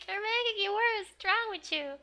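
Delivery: put-on high-pitched exasperated kid voice; exasperated girl. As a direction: squeak it out like a flustered girl. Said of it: "She's like".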